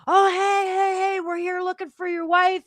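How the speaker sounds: high-pitched imitation of friends calling out; mock friendly greeting. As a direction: put on a high, sing-out hello. Voices friends greeting Dreadpool in story.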